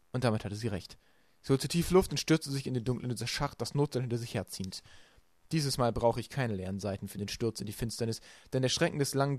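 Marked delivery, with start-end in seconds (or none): none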